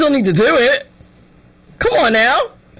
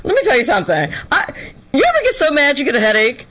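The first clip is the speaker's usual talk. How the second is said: ordinary talk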